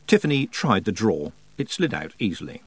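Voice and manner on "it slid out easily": ordinary delivery, nothing marked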